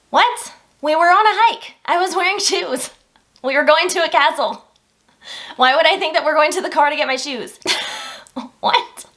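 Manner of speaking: can you believe I said that; baffled disbelief. Real, not acted. Laughing at herself, telling viewers about her migraine, incredulous.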